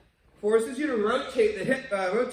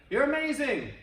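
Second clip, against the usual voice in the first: higher, bright call-out; mild mock shout; 7 semitones higher, 3 dB louder than usual. The kid shouts kindness to the valley. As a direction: call it out kindly. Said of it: "they shouted".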